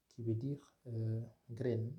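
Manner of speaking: ordinary delivery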